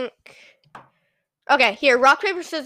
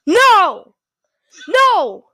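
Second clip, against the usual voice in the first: shouting no; 9 semitones higher, 8 dB louder, slower than usual. Real, not acted. Reacting to friend's sound.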